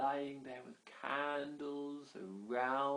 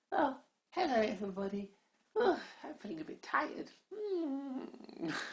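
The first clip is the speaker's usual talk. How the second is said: higher, drowsy little pixie voice; sleepy pixie; 11 semitones higher than usual. Acted. Lazy greeting everybody after waking, higher sleepy voice. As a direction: yawn it out like a tired fairy.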